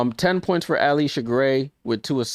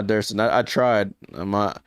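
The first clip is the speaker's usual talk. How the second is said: ordinary talk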